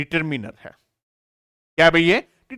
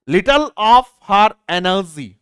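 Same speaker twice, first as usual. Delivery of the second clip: slow dictation; 3 dB louder, much slower than usual. Shown as a demonstration. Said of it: "इस प्रकार से जब of होता है".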